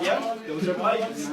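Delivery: deadpan mock. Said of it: "It's just like".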